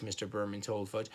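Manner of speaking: usual speaking voice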